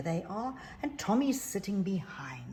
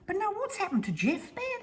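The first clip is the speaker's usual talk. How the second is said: put-on high child voice. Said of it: "they said".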